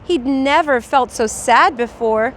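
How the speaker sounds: ordinary delivery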